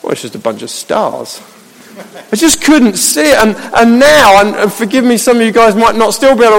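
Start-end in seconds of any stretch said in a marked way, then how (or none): 0.0-1.4 low, soft shrug of a voice; understated, deadpan indifference; unimpressed dismissal. Acted. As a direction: dismiss it as nothing special. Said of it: "But then I'll be like".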